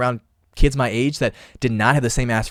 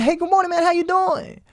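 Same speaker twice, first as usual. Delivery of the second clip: much higher, sing-song mock cheer; exaggerated bright good morning; cheery greeting; 4 dB louder than usual. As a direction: chirp it like a sunny morning. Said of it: "I said".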